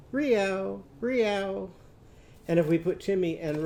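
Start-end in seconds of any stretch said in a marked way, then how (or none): 0.1-1.7 high "reow", twice; cat's meow with R. Shown as a demonstration. Teacher answers as Rosie's cat, high meowed "reow". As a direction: mew it high with an R in front. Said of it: "what's her cat going to say?".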